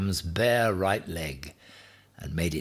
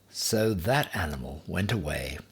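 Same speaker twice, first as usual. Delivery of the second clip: plain reading voice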